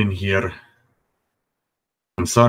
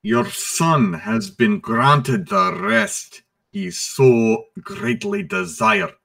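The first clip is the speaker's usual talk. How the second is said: slow, high, formal herald; solemn NPC voice; 8 semitones higher, slower than usual. Acted. Herald addressing Lord Calder, slow and formal. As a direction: announce it slowly and gravely.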